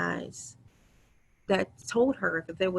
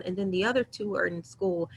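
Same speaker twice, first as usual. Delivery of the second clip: ordinary talk, nothing marked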